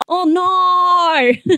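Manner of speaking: drawn-out Australian "oh no"; Australian accent. Shown as a demonstration. Australian mocking own dream, broad put-on Australian accent. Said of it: "That's me doing an Australian accent".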